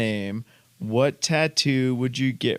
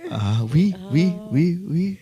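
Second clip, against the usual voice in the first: mock French oui climbing higher; French accent; 6 semitones higher than usual. Husband answering his wife's flirting, mock French accent rising. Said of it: "in your best French accent".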